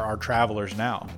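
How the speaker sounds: ordinary delivery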